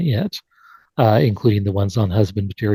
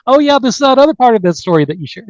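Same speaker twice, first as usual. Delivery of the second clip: excited recognition; 10 semitones higher, much faster than usual. Retold or imitated. Quoting an eager listener.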